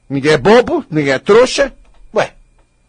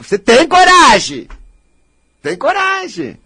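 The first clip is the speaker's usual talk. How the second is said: indignant; 7 semitones higher than usual. Real, not acted. Scolding listener's nerve.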